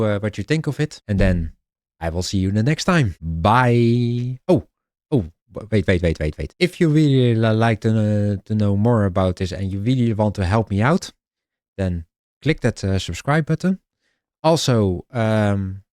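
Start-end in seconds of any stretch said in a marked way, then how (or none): none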